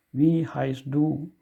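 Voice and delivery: slow, clear Wie heißt du, stress on Wie; slow repeat. Shown as a demonstration. Teacher repeats the informal question slowly for learners. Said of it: "When you say".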